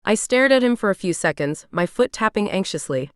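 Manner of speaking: usual speaking voice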